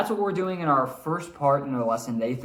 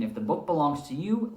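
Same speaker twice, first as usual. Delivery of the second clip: ordinary delivery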